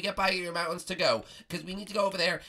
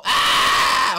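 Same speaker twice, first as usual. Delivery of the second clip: loud sudden yelp of falling; startled scream; 13 dB louder than usual. Real, not acted. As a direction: cry out as you slip. Falls off the wall.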